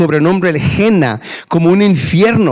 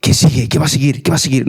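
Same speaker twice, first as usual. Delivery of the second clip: in suspense; 6 semitones lower, faster than usual. Acted. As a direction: read it like a cliffhanger, hushed and low. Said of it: "la leo así como tipo en suspenso".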